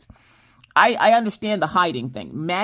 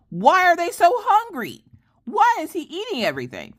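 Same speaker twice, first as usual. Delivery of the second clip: much higher, wailing why at the sky; exasperated squeal; 13 semitones higher than usual. Retold or imitated. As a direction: throw your hands up, squeaky despair. Mom exasperated at son's appetite.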